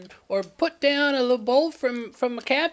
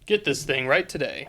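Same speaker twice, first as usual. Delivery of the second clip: deep announcer voice; 10 semitones lower than usual. Acted. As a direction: drop into the announcer's boom. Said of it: "normal T V commercial".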